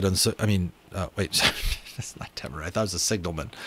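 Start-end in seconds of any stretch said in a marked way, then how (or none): none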